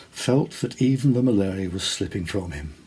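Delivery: usual speaking voice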